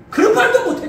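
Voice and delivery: high, loud laughing scoff; high-pitched loud jeer with a laugh; loud scornful outburst. Real, not acted. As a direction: blast it out in disbelief. Scolding pastors who cannot speak out.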